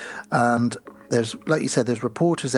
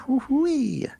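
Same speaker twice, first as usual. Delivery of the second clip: high, playful woo-wee; admiring whoop; 13 semitones higher than usual. Real, not acted. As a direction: tease him with a high whoop. Admiring the hunky actor.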